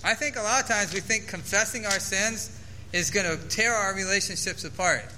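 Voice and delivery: ordinary talk, nothing marked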